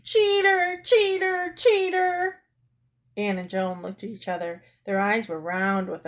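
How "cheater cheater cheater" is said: high, loud accusing chant; outraged high-pitched cry; shouting. Acted. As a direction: shout it shrieking, three times over. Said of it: "kicked at the door and shouted".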